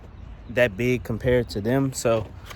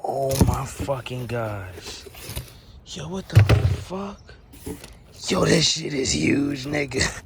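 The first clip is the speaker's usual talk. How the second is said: drawn-out disbelief building to an outburst; real shock at the size of the sub; real amazement; 3 semitones higher, slower than usual. Real, not acted. First sight of huge sub.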